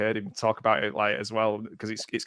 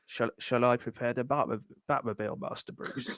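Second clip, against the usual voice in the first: put-on softer, fading old butler voice, dropping to breath at the end; old Michael Caine; 9 dB quieter than usual. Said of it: "he's kind of broken".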